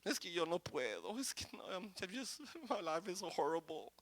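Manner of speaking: hint of someone wallowing in self-pity; self-pitying believer. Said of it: "porque usted comienza a decir". (quejido burlón de autocompasión)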